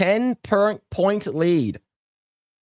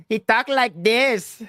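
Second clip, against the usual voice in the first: Sofia Vergara voice; 5 semitones higher, slower than usual. Retold or imitated. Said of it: "Sofia Vergara's voice is very".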